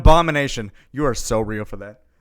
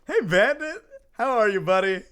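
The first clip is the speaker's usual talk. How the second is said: higher, louder welcome; excited greeting; 8 semitones higher, 6 dB louder than usual. Real, not acted. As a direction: greet a buddy brightly and loudly. Streamer greets Bandit on arrival.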